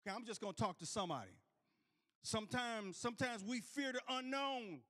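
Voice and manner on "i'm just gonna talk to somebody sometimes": ordinary delivery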